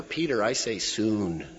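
normal talking voice

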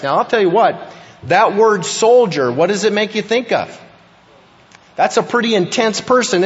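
preaching at full force. Real, not acted. Pastor firing up the congregation about soldiers, preaching hard. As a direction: preach it to the back row.

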